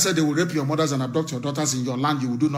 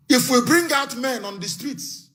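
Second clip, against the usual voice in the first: ordinary talk, nothing marked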